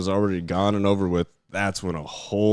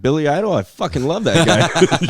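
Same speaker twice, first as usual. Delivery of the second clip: loud, higher burst of fan enthusiasm; excited mishearing; 8 semitones higher, 7 dB louder than usual. Retold or imitated. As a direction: gush with delight, replaying your own reaction. Retelling his mistaken delight at name.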